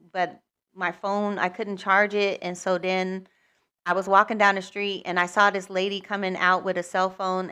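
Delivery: ordinary delivery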